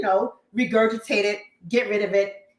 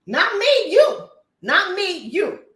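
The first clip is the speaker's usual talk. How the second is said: loud, high "Not me, you"; hammed-up loud finger-pointing; mock accusation; 8 semitones higher, 5 dB louder, slower than usual. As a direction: shout it back like a taunt.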